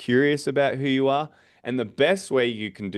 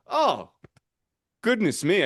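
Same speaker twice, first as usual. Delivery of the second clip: much higher, a light gasp of fake surprise; mock surprise; 8 semitones higher than usual. Shown as a demonstration. Salesman to homeowner, forgetting his name: high mock surprise. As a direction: catch yourself, playfully flustered. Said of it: "And I'd be like".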